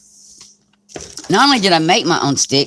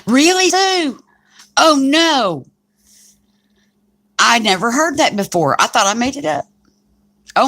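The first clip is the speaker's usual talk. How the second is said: much higher mock dismay, overdone; exaggerated gasping shock; 5 semitones higher than usual. Patient in the hospital feigning shock, higher and hammed-up. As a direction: ham up the shocked apology.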